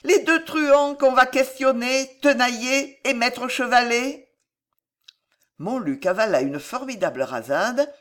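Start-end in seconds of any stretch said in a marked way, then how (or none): 0.0-4.3 put-on higher, louder sweet girl voice; gently. A narrator's reading. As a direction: cheerfully explain to the drunk lord. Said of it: "dit gentiment Paquette".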